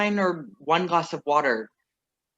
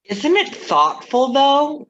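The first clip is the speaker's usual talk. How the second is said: gushing; 10 semitones higher, 7 dB louder, slower than usual. Acted. Singer gushing over ex's letter.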